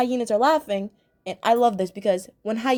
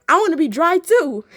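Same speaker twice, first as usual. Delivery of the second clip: eager hyenas; 6 semitones higher, 6 dB louder than usual. Retold or imitated. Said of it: "they're like".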